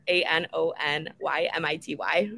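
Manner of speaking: letters spelled out one by one; spelling it out. Shown as a demonstration. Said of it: "how do you say this word?".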